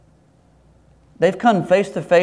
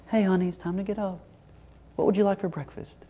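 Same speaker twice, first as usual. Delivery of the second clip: soft, higher, quick coaxing voice; gentle wake-up; 5 semitones higher, 10 dB quieter, faster than usual. Acted. Parent waking the kids for school, gentle wake-up. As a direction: gently rouse a sleeping child, softly.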